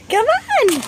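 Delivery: high laughing come on; laughing coax. Real, not acted. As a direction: laugh while coaxing. Laughing coaxing dog.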